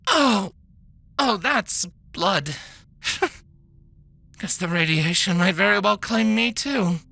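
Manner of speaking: shocked at blood, laughing it off; shaken doctor. Acted. Doctor sees coughed-up blood.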